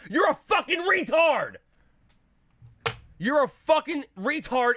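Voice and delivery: high, drawn-out angry yell; furious insult. Real, not acted. Streamer attacking viewers who expect no delay, furious. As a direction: spit the insult with real rage.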